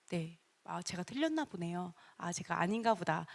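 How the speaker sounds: hushed, self-effacing concession; humble backing down. Shown as a demonstration. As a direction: retreat meekly, almost to yourself. Models backing down humbly when challenged.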